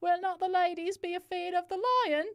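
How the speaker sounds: a nervous player's put-on voice; put-on character voice. Another character voice.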